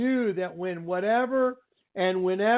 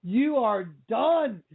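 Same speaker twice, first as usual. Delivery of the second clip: drawn-out, higher "oh"s of disbelief; stunned movie reaction; 3 semitones higher, much slower than usual. Retold or imitated. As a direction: re-enact being blown away by a film, stretching the words. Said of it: "I was in here literally going".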